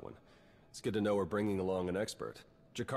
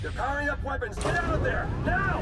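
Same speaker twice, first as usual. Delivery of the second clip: urgent shout; 9 dB louder than usual. Acted. Urgent warning over comm.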